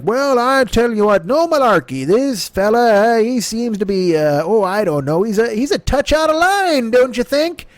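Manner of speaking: a rambling grandpa telling you off; folksy Biden. Retold or imitated. Mocking Biden's folksy talk as a cookie truck driver. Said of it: "quit talking like the fucking Pepperidge Farm cookie truck driver".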